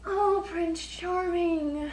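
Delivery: slow, high, dreamy prince; drawn out like a fairy-tale prince; Prince Charming voice. Pretend.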